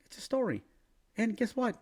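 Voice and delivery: ordinary talk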